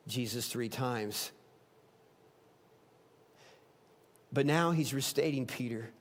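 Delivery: quiet, reassuring sermon close; gentle pastoral appeal. Real, not acted. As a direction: speak straight to each listener's heart. Gentle pastoral close after climax.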